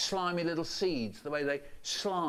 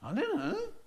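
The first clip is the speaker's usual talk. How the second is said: a much higher, rising I don't know; innocent child. Retold or imitated. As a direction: play the clueless kid. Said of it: "And I say".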